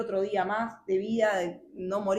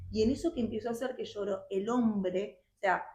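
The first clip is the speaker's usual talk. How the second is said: ordinary talk, nothing marked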